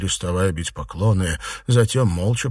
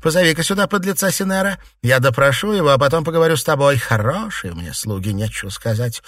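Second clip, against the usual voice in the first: high, fast, loud scolding; angered; 9 semitones higher, 5 dB louder, faster than usual. A narrator's reading. Mistress berating a servant who hid the truth, angered. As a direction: scold in a rush, then ease into bitter sarcasm. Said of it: "рассердилась Фэнзе".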